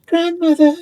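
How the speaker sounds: put-on high, loud cry of a little girl; exclaimed. A narrator's reading. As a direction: call out to grandmother in a child's voice. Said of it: "exclaimed the child".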